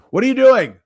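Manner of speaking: sudden loud call across the house. Real, not acted. Calling out to the kids outside.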